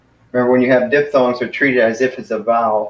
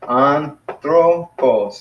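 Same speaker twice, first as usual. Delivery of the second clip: "anthropos" very slowly, broken into three syllables, higher pitch; 4 semitones higher, much slower than usual. Shown as a demonstration. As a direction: sound out "anthropos" as an-thro-pos. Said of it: "three syllables".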